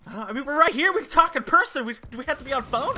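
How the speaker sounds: high, loud mock complaint; worked-up retold objection; exasperated protest. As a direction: plead in exasperation, acting it out. Acts exasperated protest at game.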